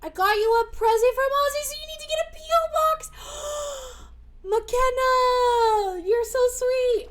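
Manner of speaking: high, loud, thrilled gush; excited high-pitched delight; gushing excitement. Real, not acted. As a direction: squeal it, touched by the gift. Gushing over viewer's gift.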